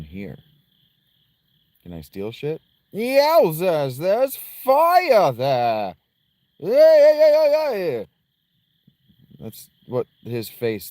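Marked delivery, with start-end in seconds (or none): 2.9-5.9 loud, high, hammed-up alarm; silly character voice. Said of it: "I don't know why I'm doing that voice either". 6.6-8.0 very high, loud wobbling wail; silly face noise. Retold or imitated. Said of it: "that's the noise his face made".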